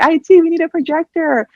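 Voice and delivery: high, bright fake-official ask; fake innocent request. Young temp asking IT for a projector, high fake innocence. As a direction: sell the fake meeting in a high voice. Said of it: "I was like".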